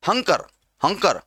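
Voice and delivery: हंकर, Shankar mispronounced with an h; h replacing sh. Shown as a demonstration. Teacher shows students a common error, h for sh in हंकर. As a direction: open हंकर on a breathed h, no sh. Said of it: "शंकर को हम लोग कभी और हंकर ही बोलते हैं".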